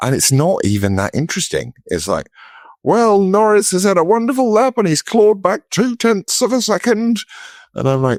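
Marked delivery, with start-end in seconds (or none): 2.8-7.2 very high, excited motorsport commentary; mock race commentator. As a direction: parody the commentator, pitched way up.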